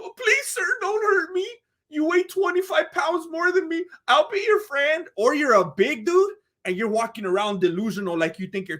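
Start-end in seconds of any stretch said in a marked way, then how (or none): 0.0-5.1 high, whimpering mock plea; hammed-up cowering, pitched way up; mock frightened pleading. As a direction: beg like a scared small guy. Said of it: "walk around in fear of your life".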